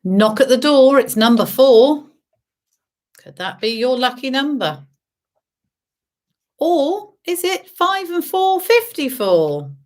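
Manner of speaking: lilting bingo call, high on the rhyme; bingo caller. Acted. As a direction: sing out the numbers. Bingo calls.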